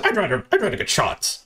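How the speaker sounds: high-pitched imitated protest; mock refusal. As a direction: squeak out the refusal as a mocking quote. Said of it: "he said".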